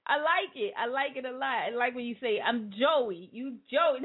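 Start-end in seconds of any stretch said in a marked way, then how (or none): none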